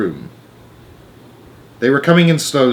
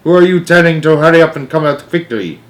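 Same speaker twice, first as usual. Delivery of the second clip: loud, higher demand; 6 semitones higher, 6 dB louder than usual. Acted. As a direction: interrogate her sharply. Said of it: "snapped the Grand High Witch".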